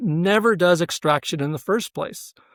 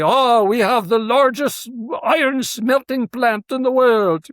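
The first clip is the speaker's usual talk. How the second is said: a hammed-up, slightly higher stab at a Russian accent, louder; attempted Russian accent; 8 semitones higher, 3 dB louder than usual. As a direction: playfully imitate Stalin with a half-hearted Russian accent. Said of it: "I can't do a Russian accent".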